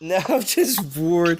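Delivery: slow, drawn-out bored mimic; tired voice. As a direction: imitate a worn-out friend, slowly. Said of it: "That's J J's tired voice".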